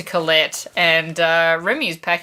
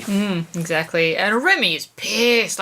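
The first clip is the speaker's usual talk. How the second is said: normal talking voice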